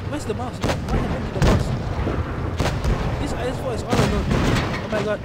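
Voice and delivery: excited alarm. Real, not acted. Commentator losing track of the Mouse, loud excited alarm. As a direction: call it out loudly, caught up in the action. Caster excited as battle turns chaotic.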